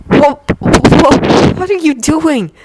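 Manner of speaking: high, stammering nervous question; a frightened boy stumbling over his words. Acted. Frightened Yuri stammers at Victor, high and nervous. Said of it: "Yuri had asked nervously".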